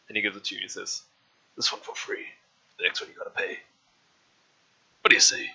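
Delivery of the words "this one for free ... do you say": a hushed, gruff butcher murmuring his offer; shady butcher talking low over his cleaver. Acted. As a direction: lean in and sell him the meat on the quiet. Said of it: "I've been doing the same voice".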